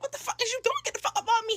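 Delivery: high, mock-outraged outburst; indignant protest. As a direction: react as if suddenly grabbed. Retells protest at being grabbed.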